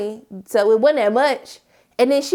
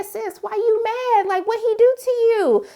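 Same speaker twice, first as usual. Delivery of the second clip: sassy concern; 10 semitones higher than usual. Retold or imitated. Asking friend why she's mad.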